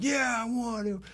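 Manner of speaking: high, eager caller voice; eager radio caller. Retold or imitated. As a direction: pipe up as a keen phone-in contestant. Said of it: "And the guy calls in".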